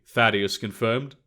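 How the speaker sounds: usual speaking voice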